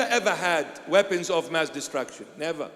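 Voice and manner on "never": ordinary delivery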